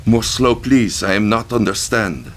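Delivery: put-on high, loud woman with a French and Vietnamese accent; young woman's French-Vietnamese accent. A narrator's reading. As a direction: speak with a French-Vietnamese lilt. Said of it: "Her accent was a strange mixture".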